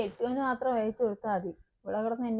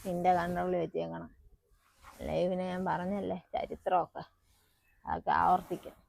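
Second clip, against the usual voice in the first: confiding undertone; 3 semitones lower, 6 dB quieter than usual. Acted. Mother gives secret advice softly.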